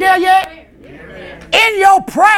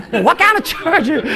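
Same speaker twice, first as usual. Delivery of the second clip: ordinary talk, nothing marked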